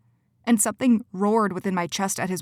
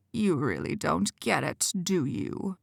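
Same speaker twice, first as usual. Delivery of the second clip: quiet menace; 2 semitones lower, 5 dB quieter, slower than usual. Acted. Quentin mocks Cora's ignorance, soft and menacing. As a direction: lean in and threaten gently. Villain voice in the story.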